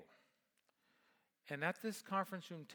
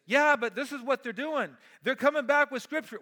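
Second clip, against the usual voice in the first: mock objection; 10 semitones higher, 16 dB louder than usual. Mocking critics quoting scripture back.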